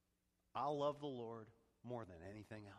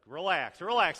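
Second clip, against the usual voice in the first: loud, high reassurance to the congregation; calm them down, loud and bright; reassuring; 6 semitones higher, 17 dB louder than usual. Real, not acted. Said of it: "Some of you are frowning".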